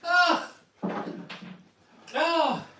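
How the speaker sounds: high, loud shocked yelp; shocked cry. Real, not acted. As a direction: cry out at the sight. Watching Tom eat it all.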